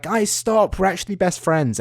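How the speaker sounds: high, whiny girlish mimicry, lightly done; mock girly protest. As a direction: mimic a girl insisting you're best friends.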